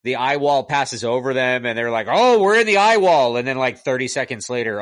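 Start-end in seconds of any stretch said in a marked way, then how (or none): 2.1-3.3 loud, high mimicked exclamation; mock exclamation. Reviewer mimics movie characters in the eye wall, mock exclamation. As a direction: call it out as a movie character would.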